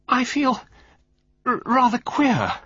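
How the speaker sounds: very high, slow, broken gasps; gasping for breath. A narrator's reading. Valentine poisoned in her chair, gasping for breath. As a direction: struggle to get the words out. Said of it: "she gasped, fighting for breath".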